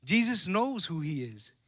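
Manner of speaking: ordinary delivery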